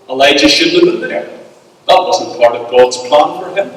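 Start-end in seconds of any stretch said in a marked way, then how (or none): none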